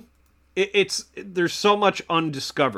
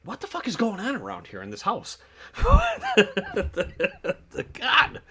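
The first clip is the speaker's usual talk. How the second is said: laughing disbelief; 8 semitones higher, 3 dB quieter than usual. Real, not acted. After spider scare, laughing.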